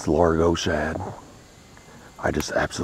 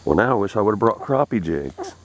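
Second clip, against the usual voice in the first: usual speaking voice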